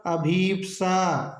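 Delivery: अभीप्सा said slowly with a long ई; slow correct pronunciation. Shown as a demonstration. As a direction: dictate अभीप्सा slowly, stressing the बड़ी ई. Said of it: "तो शुद्ध होगा". (अभीप्सा धीरे से, लंबी ई के साथ)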